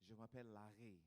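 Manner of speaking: soft throaty R in "Larry", quiet and posh-sounding French; fancy French accent. Shown as a demonstration. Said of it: "I'm trying to be fancy".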